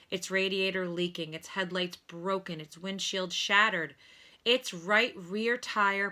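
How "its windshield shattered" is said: plain reading voice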